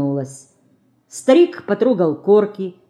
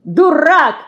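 hot, high, louder insult; flaring up; 9 semitones higher, 6 dB louder than usual. A narrator's reading. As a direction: flare up in a high, loud voice. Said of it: "вспыхнула Динка".

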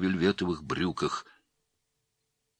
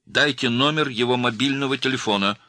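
higher, insistent demand; angry demand; 8 semitones higher, 5 dB louder than usual. A narrator's reading. Girl demanding a phone number, angry. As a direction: press angrily for the number. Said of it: "разозлилась девушка".

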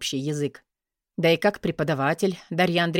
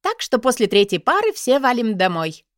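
excited; 6 semitones higher, 6 dB louder than usual. A narrator's reading. Same girl continuing.

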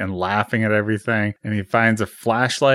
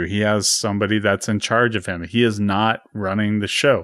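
ordinary delivery

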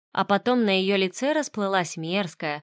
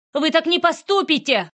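much higher, louder plea; desperate cry; 9 semitones higher, 5 dB louder than usual. Acted. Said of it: "отчаянно выкрикнула я". (отчаянный выкрик)

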